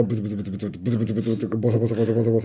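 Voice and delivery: rapid repetitive muttering; self-mocking fast mumble; mumbled grumbling. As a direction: rattle it off like someone mumbling to himself. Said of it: "なんか俺がブツブツ".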